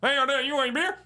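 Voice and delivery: high, loud hammed-up Alabama twang; Alabama accent. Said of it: "Alabama's like".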